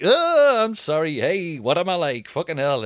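high, exaggerated oops-sorry; pantomime apology. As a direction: ham up a sheepish apology, starting on a loud high "oh". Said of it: "like, pantomime".

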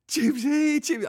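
very high, loud cooing of the name; cat baby talk. Retold or imitated. Calling his cat Ruby by pet name, cooing. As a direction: sing the pet name sweetly. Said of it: "So I just go like".

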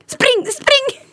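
very high-pitched mock getaway cry; urgent mock shout. Brother setting off the prank with the children, urgent mock shout. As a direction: call it out high, egging them on.

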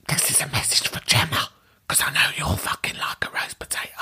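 whispered teasing message back; mock whisper. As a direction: whisper it like a secret voicemail. Mimics caller's whispered message.

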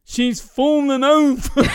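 slow, high, loud garbled shout; comic impeded-speech voice at full volume; speech impediment. Acted. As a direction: call out the punchline with muddled consonants. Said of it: "the guy sounds deaf".

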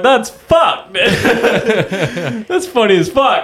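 laughing, high and loud; cracking up while saying it. Retold or imitated. Laughing at rival in corner.

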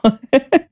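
bright short laugh; a quick grateful giggle. Real, not acted. Laughs mid-sentence about editor.

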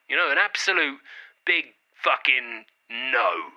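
"no" as a firm, deliberate full stop; emphatic no. Shown as a demonstration. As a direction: space out the words and land hard on "no". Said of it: "This is a no".

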